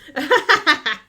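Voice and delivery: high, loud burst of laughter; real high-pitched laugh. Real, not acted. Laughs at chat.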